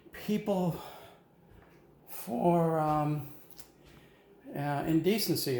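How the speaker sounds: high-pitched, insistent retold pitch; eager persuading. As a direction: talk a reluctant pal into it. Acts out persuading his reluctant pal.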